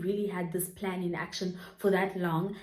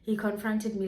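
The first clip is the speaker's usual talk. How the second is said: normal talking voice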